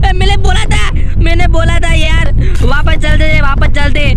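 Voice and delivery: loud, high panicked shout; scared friend yelling I told you so. Real, not acted. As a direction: scream it, terrified. Panic as uncle comes.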